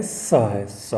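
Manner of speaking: स said as a plain hissing s; स as in seven; s sound demo. Shown as a demonstration. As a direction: repeat स slowly with a clean s. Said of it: "इसका sound क्या है?".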